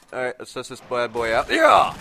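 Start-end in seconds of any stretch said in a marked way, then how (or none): 1.5-1.9 high, loud victory cheer; gleeful shout of yeah; triumphant yell. Real, not acted. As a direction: let it rip with joy. Firing new weapon.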